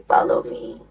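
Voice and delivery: breathy whispered follow me; hushed whisper of follow me. Shown as a demonstration. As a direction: whisper it like a secret. Repeating follow me variously.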